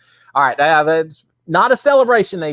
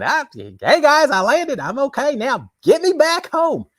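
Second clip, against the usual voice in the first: higher, slow mock radio call home; astronaut on Mars; 12 semitones higher, slower than usual. Astronaut radioing back from Mars, in a mock slow high voice. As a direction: play a stranded astronaut calling mission control. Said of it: "and radio back saying".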